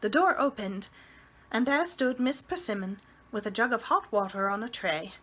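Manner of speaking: plain reading voice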